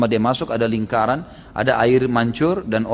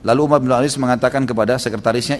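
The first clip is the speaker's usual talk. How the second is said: ordinary talk, nothing marked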